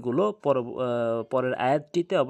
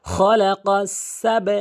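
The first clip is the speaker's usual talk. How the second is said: slow, higher, drawn-out tajweed chant; Quran recitation; 5 semitones higher, 9 dB louder, much slower than usual. Shown as a demonstration. Teacher recites the verse for learners, measured Quran recitation. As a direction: recite the verse with long held vowels.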